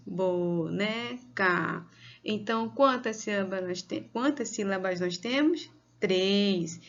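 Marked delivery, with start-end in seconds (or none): 0.1-1.9 very slow, stretched "bo-ne-ca"; syllable by syllable. Shown as a demonstration. Teacher showing three syllables, syllable by syllable. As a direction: separate each syllable of "boneca". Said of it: "Três vezes".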